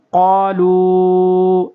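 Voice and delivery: قَالُوا with the long aa drawn out slowly; long stretched qaalu. Shown as a demonstration. Teacher demonstrates the madd, stretching قَالُوا slowly. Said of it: "ایسے پڑھیں گے۔".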